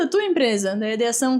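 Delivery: ordinary talk, nothing marked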